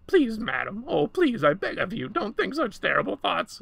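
high, loud pleading creature voice; creature crying out. A narrator's reading. Creature begs madam to stop her thoughts, high desperate cry. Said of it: "it cried".

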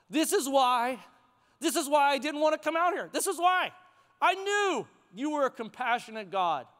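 acted indignant complaint, pitch riding high; upset. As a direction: protest to God like an aggrieved prophet. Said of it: "he is upset".

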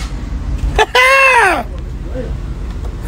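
long high giggle; gleeful high laugh. Real, not acted. Laughs after boast.

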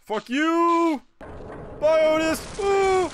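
high, loud drawn-out hollers; excited yelling. Real, not acted. Streamer yelling goodbye to Otis mid-game, high excited holler.

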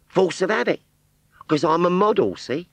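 put-on high Cockney girl's voice; rag trade Cockney. Scarecrow model answering Wurzel in high Cockney. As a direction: pert Cockney shopgirl reply.

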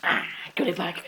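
usual speaking voice

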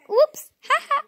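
gasping whoops into a laugh. Real, not acted. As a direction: laugh it off, high and giggly. Gasp and laugh heard.

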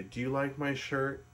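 usual speaking voice

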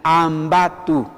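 slow, measured Ambato with a 'tu' ending; pronunciation demo. Shown as a demonstration. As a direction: sound out Ambato for learners. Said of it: "así".

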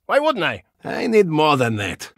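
usual speaking voice

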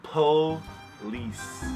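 warped backwards Police; reversed playback. Shown as a demonstration. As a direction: sound Police like rewound tape. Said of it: "That's pretty good".

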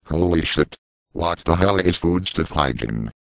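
ordinary delivery

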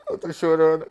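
loud mock wail; imitating fake crying. Showing fans how he faked crying, a loud mock wail. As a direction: ham up a fake sob. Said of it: "E eu ficava assim, ó".

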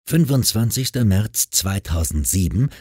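plain reading voice

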